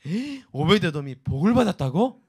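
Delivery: David catching the rumor, astonished; surprised David. Retold or imitated. (깜짝 놀라 되묻는 다윗)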